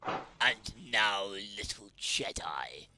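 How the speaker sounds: a hushed villain, very low; Sith lord voice. Pretend. Menacing Sith quote.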